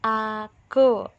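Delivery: aku said clearly and loudly, every sound crisp; correct pronunciation. Shown as a demonstration. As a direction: pronounce aku carefully, a-ku. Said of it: "bunyi yang kita keluarkan juga harus".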